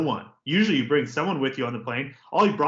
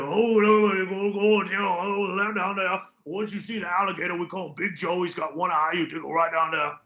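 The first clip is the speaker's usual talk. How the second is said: put-on Louisiana accent; 4 semitones higher than usual. A Louisiana local giving a lost traveller directions in a Louisiana accent. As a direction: ham up a Louisiana local pointing the way. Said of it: "they speak their own damn kind of English".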